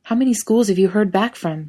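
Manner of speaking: mild pressing interrogation; pushing. A narrator's reading. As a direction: push for an answer, a touch exasperated. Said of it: "Dean pushes".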